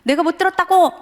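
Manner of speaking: a father losing his temper at his son, retold shout; mock angry shout. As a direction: blow up at the back-talk. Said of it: "점점 서로 격해".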